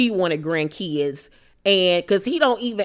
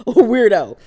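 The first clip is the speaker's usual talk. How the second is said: laughing it out, louder; 4 semitones higher, 7 dB louder than usual. Real, not acted. Host cracking up at her own joke, laughing. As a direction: blurt the word through a real laugh.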